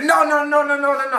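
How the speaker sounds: high, loud string of no's; pleading salesman. Retold or imitated. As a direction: stop her at the door, high and urgent. Salesman stops her again.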